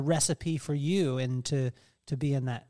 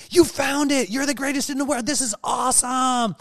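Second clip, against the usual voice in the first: much higher, louder excited praise; imagined praise; 8 semitones higher, 6 dB louder than usual. Retold or imitated. Imagining her husband praising her idea, excited. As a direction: gush like a proud fan. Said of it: "I thought you were gonna be like".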